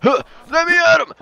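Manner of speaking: high, loud fighting shout; battle cry. Real, not acted. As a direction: charge in yelling. Eager to fight.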